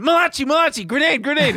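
high, loud panicked gamer shout; grenade warning yell. Retold or imitated. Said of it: "I just was going".

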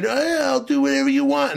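high mocking voice; squeaky sucking-up impression; mock eager yes-man. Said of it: "to saying".

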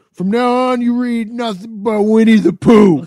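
high, loud drunken dad impression; mock a plastered father laying down the law; drunken slurred rant. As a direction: slur it out as a loud drunken decree. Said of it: "then he has the drunken, slurred line like".

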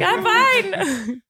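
mock panicked high reply. Singer retelling this morning's stressful check-in, mock panicked. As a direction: reenact a startled, too-high answer. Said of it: "I panicked this morning".

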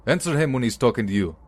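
fast furious shout at the captive; screaming. A narrator's reading. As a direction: bark it right in his face.

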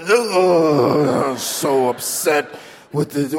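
hammed-up weepy complaint at work; mock crying coworker. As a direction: fake sobbing whine. Mocking upset coworker.

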